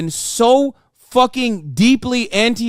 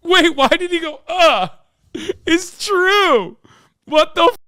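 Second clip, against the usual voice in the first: high, incredulous questions; can't believe what he just saw; baffled disbelief; 10 semitones higher than usual. Real, not acted. Streamer baffled by the debater's reaction, high and incredulous.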